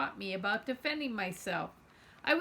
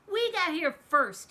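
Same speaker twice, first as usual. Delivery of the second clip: defiant; 6 semitones higher, 6 dB louder than usual. Acted. Zenny clenched fists, defying bully.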